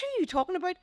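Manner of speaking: baffled question. Retold or imitated. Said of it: "I said".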